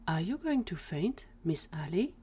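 whispery little voice of concern; tiny worried whisper. Acted. Tiny Gwen worries Ali will faint, hushed concern. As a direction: ask it in a small, hushed voice.

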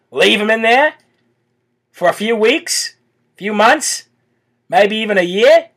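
mock Australian accent with rising line ends. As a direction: put on a chirpy mock Aussie official, pitch climbing at the ends. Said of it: "you're creeping me out with that accent".

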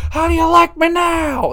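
very high, loud mock yell; imitated yell. Wrestling reviewer imitating a legend's outburst, high-pitched yell. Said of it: "he just yells".

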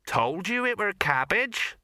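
high, slow country farmer; rustic farmer. A narrator's reading. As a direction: crow it like a vindicated yokel.

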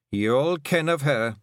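lightly voiced dying old kelder, solemn; a hint of an old clan matriarch giving orders. A narrator's reading. Old kelder naming Tiffany to her clan, lightly voiced.

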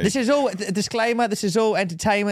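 high-pitched, insistent announcement; mock disclaimer. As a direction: call it out like a hasty legal disclaimer. Mock disclaimer called over the talk.

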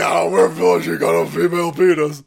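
loud, jeering mock voice; mocking shout. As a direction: yell it like a taunting crowd.

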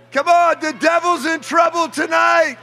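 high shouted repeat of the line; fired-up preacher shouting the phrase; preacher's shout. Shown as a demonstration. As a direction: belt it out to the whole room. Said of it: "look at your neighbor and say".

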